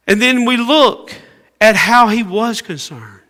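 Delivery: loud, high preaching; preaching at full force. Acted. Preacher stressing the disciples' fear in verse thirty-eight. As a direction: press the point on the congregation.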